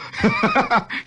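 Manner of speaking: high real laughter. Real, not acted. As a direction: burst of laughter. Laughing at father.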